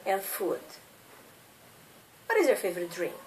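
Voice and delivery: ordinary talk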